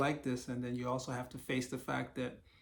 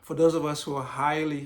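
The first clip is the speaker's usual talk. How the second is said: throat cleared, "those" said clearly and louder; louder restart; 2 semitones higher, 10 dB louder than usual. Real, not acted. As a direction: restart "For those of us who are highly" in a fresh, louder voice. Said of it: "excuse me".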